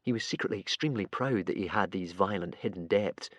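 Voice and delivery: ordinary delivery, nothing marked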